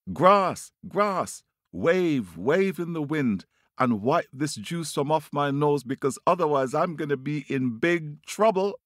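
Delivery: high, urgent bird calling for help; calling out, pleading. Acted. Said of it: "he calls out to the grass".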